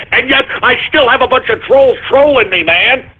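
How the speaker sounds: ordinary talk, nothing marked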